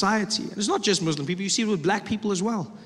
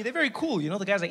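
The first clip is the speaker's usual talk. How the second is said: ordinary delivery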